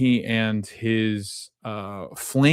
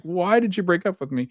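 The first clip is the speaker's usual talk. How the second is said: mock hurt question; 8 semitones higher than usual. Sean dumped by older woman.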